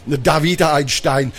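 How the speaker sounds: usual speaking voice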